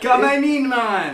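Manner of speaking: loud, high mock Jamaican accent. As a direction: call out in a hammed-up Jamaican accent. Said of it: "make him Jamaican".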